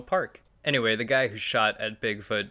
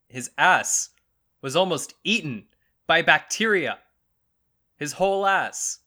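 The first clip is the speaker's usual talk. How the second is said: loud, high, hammered-out phrases; mock emphatic shouting; 7 semitones higher, 8 dB louder than usual. Host checking listeners follow this, mock emphatic shout. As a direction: punch each phrase loudly with pauses between.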